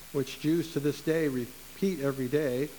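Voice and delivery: normal talking voice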